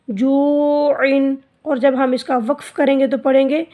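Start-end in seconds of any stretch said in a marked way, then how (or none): 0.1-1.4 जू इन held long and slowly, the न clear; clear izhar recited. Shown as a demonstration. Teacher reciting the joined word for pupils, slow. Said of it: "इजहार होगा".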